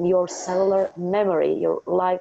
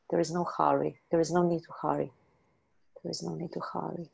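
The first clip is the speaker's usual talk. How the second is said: soothing; 7 dB quieter, faster than usual. Real, not acted.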